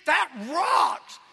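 loud, long shouts climbing to a high whoop; rowdy crowd member. Acted. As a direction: holler out like the sermon's biggest fan. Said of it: "you can hear somebody in the crowd going".

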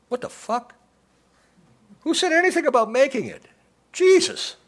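high, loud indignant shout; outraged yell. Acted. Noonan shouting at Stacy in shocked outrage. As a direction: blow up in shocked indignation at her.

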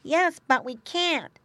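high, slow little pig voice; a piglet gently saying no; piglet brother. A narrator's reading. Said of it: "said Wuff Wuff".

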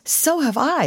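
put-on high, girlish eager agreement; eager exclamation. A narrator's reading. Young girl agreeing with the actor about Florida, eager exclamation. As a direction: chime in eagerly, pitched high. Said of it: "exclaimed a young girl".

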